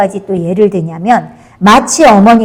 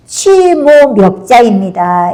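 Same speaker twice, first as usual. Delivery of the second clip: slow, drawn-out quoted verdict; deliberate, lingering retold accusation; pointed accusation; slower than usual. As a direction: stretch out the accusing label slowly. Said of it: "이렇게 이야기해요".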